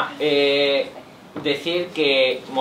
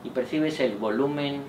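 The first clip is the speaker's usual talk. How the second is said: guided meditation; 4 semitones lower, 7 dB quieter than usual. Acted. Leads a relaxation meditation.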